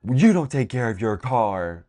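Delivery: loud mock scolding; flipping out. Mom scolds her kid about the car, flipping out. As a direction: imitate a mom flipping out at you. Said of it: "she literally will flip out on me".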